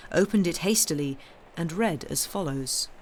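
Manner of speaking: ordinary delivery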